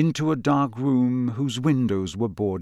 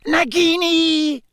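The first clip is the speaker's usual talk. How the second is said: very high, slow villain call; cold voice; 4 dB louder, slower than usual. Acted. Voldemort calling his snake, cold high voice. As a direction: draw the name out high and icy. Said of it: "said the cold voice".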